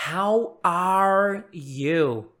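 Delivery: "How are you" very slow, each word separate; contrasting HOW ARE YOU. Shown as a demonstration. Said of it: "Não confunda isso com". ("How are you" bem devagar, palavra por palavra)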